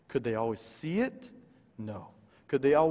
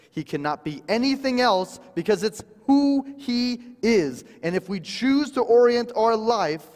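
slow, weighty "God is faithful", then louder and higher; preaching at full force; 4 semitones higher, 7 dB louder, slower than usual. Acted. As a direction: build to a fervent pulpit cry. Preaching climax.

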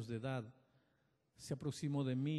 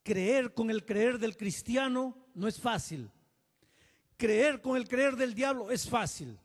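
loud, raised preaching voice; emphatic preaching; 7 semitones higher, 7 dB louder than usual. Acted. Pastor exhorting congregation, loud and emphatic. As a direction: drive the point home to the crowd.

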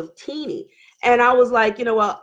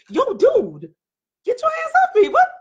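high, pitched-up retort; indignant protest; 13 semitones higher than usual. Retold or imitated. Wife to husband yanking on her arm, indignant. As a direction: protest sharply, voice leaping up.

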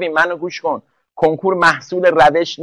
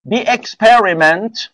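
slow, showy English accent, ending on a rise; put-on English accent; 3 semitones higher, much slower than usual. Shown as a demonstration. Teacher reading a test sentence, showing off an English accent. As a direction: stretch it out in an affected English accent. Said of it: "خودم لهجه اومدم ها".

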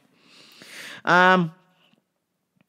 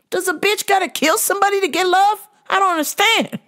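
much higher, pleading mock outrage; high exasperation; 12 semitones higher, faster than usual. Host venting at ladies, high and exasperated. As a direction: whine it up high in disbelief. Exasperated rant about love.

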